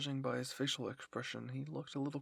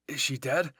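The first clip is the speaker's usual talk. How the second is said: urgent worry; 10 dB louder than usual. A narrator's reading. Worried question after a fight.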